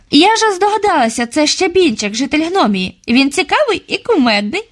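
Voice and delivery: put-on a young girl pleased with her guess; girl's voice. A narrator's reading. Girl Yulia speaks. (дзвінкий голос дівчинки, жваво)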